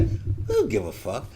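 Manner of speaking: low, soft, bored mutter of the stranger; imitating stranger's indifference. Mimicking the Walmart stranger who doesn't care, low and soft. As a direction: voice her not caring one bit, dropped and quiet. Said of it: "that's what she had in her face".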